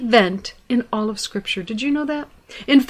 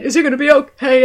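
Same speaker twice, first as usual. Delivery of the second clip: tearful worried question; 3 semitones higher, 5 dB louder, faster than usual. Retold or imitated. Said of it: "And I'm crying".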